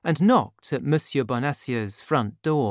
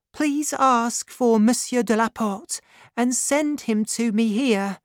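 put-on high, light grateful young woman; 9 semitones higher than usual. A narrator's reading. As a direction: lift the voice for the young heroine.

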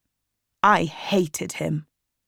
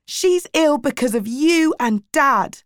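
higher, heated accusation; angry shout; 8 semitones higher, 3 dB louder than usual. A narrator's reading. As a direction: shout the blame at them. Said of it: "I shouted".

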